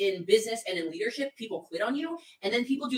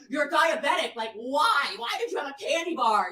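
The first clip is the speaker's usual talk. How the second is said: louder, higher exasperated scolding; 5 semitones higher, 4 dB louder than usual. Retold or imitated. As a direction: scold her in disbelief. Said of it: "He's like".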